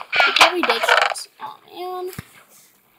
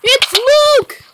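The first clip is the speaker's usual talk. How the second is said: excited shout; 9 semitones higher, 6 dB louder than usual. Real, not acted. Spotting player in game.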